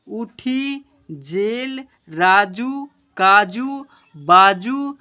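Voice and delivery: slow, drawn-out syllables for pupils; slow reading aloud. Shown as a demonstration. Teacher reading slowly to children, drawn-out. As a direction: read each word out slowly. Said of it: "यहाँ पर क्या लिखा हुआ बच्चों".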